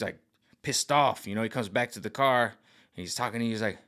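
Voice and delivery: normal talking voice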